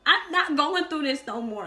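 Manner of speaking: loud, high-pitched acted protest; fed-up refusal. Her answering dad's joke about doing it again, fed up. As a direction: imitate yourself refusing, emphatic and fed up. Retold refusal to dad in labor.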